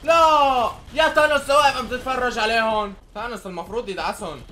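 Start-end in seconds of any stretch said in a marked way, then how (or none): 0.0-2.9 yelling high and loud at Thanos; real furious outburst at a useless teammate; exasperated scream; angry shout. Real, not acted. Thanos stands watching teammates die.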